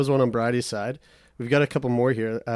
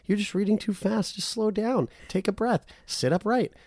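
higher, gentle reassuring tone; coaxing self-talk, pitched up; soothing inner voice; 8 semitones higher than usual. Retold or imitated. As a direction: talk yourself down like a kind friend. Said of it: "the other voice is like".

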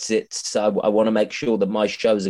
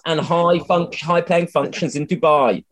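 raised pitch, playful advertising patter; mock sales plug; 7 semitones higher than usual. Comedian offering old character for paid bookings, mock salesmanship.

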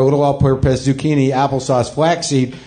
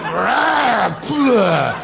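hawking up a loogie; 6 semitones higher than usual. Retold or imitated. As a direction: imitate a guy hawking up phlegm. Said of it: "he just went".

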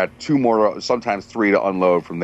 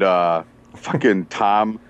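usual speaking voice

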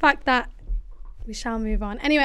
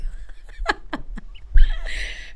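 high laugh. Real, not acted. Laughs at her own question.

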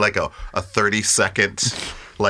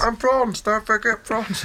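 very high, insistent heckler voice; guy at the back; 14 semitones higher than usual. Acted. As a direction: chime in from the back, pitched way up. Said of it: "one guy at the back saying".

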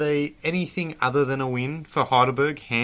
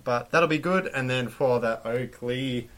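ordinary delivery

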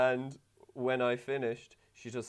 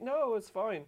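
high-pitched placating voice; uneasy reassurance; 7 semitones higher than usual. Retold or imitated. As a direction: pitch it up and brush it off. Retold reassurance to upset partner.

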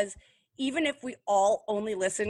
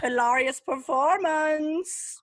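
mock acclaim; 6 semitones higher, 5 dB louder, much slower than usual. Real, not acted. Mock-praising the faked accent.

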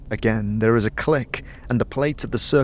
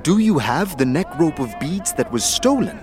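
higher, stilted third-person speech; odd formal monk; 6 semitones higher than usual. Acted. Warrior monk asking after his necklace, stilted. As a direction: speak formally, a little high. Porphyron voiced by GM.

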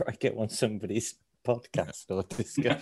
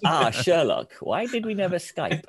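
playful Moriarty voice; 7 semitones higher than usual. Pretend. Playing Moriarty to Sherlock.

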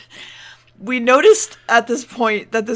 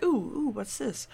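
put-on curious teens; 10 dB quieter than usual. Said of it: "They're like".

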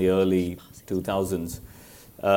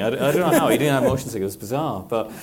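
ordinary talk

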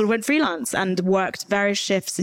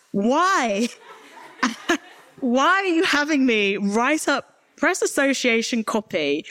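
exasperated outburst; 5 semitones higher, slower than usual. Real, not acted. Venting at the BBC.

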